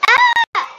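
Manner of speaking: very high piercing scream. Real, not acted. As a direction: shriek in shock. Sudden scream.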